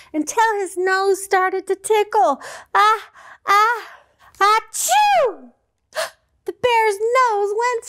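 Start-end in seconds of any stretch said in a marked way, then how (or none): 2.7-5.5 high drawn-out sneeze build-up; ah, ah, achoo sneeze; big sneeze. Acted. Bear sneezes.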